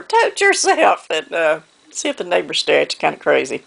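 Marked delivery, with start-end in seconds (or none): 0.0-1.1 high, drawn-out mock holler; playful holler. Shown as a demonstration. Telling viewers how to chase off a dog, playfully hollering. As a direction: stretch the call out high, for fun. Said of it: "just run out and holler".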